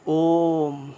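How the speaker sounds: ओम stretched very long and loud; drawn-out Om. Shown as a demonstration. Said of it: "बहुत ज़्यादा समय लगा".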